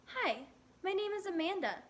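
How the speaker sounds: bright, high cheerful greeting. A narrator's reading. Girl trying out a new name in the mirror, cheerful. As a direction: introduce yourself brightly to the mirror. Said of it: "she said cheerfully".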